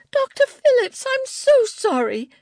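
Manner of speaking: very high, loud shrieked apology. A narrator's reading. Angela apologizing to the librarian over fallen books, shrieking. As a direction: shriek it out in horror, far above normal pitch. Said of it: "Angela shrieked".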